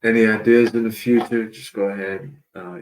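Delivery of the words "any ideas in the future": usual speaking voice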